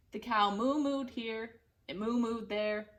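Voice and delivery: ordinary delivery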